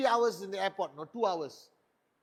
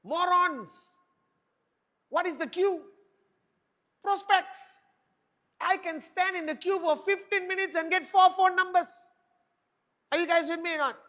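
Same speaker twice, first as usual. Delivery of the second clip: high fired-up preaching; 9 semitones higher, 5 dB louder than usual. Real, not acted. Preaching hard to audience.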